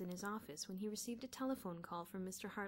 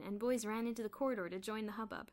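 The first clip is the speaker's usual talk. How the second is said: ordinary delivery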